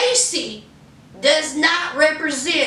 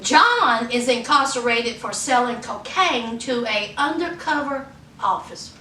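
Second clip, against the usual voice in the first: ordinary delivery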